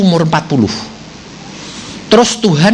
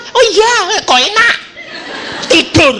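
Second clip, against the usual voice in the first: put-on high, laughing drowsy child voice; a boy mumbling back to sleep; sleepy boy; 12 semitones higher than usual. Sleepy son voiced in story. (anak mengantuk bersuara tinggi)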